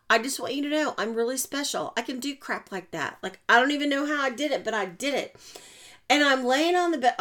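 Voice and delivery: ordinary talk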